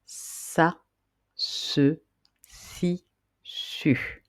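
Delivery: sa, se, si, su with a hissed S, si and su nearly whispered; syllables sa se si su. Shown as a demonstration. Teacher models sa se si su for pupils to repeat. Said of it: "on peut former les syllabes".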